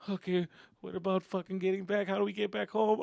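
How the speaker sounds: high-pitched anxious questioning; worried kid. Retold or imitated. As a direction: fret like a scared child. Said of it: "getting a little fucking worried".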